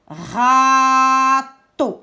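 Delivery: "Râteau" split as a long loud râ, then teau; syllable by syllable. Shown as a demonstration. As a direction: hold the first syllable of "Râteau", then drop in the second. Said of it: "Il y a deux syllabes". (« Râteau » découpé en deux syllabes, la première allongée)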